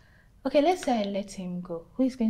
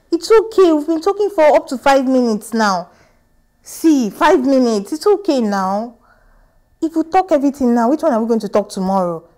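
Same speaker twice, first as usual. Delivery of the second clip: louder and higher, begging him to stop; exasperated pleading; 3 semitones higher, 9 dB louder than usual. Acted. Girlfriend begs boyfriend to end the call, loud and pleading. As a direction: beg off the call, worn out.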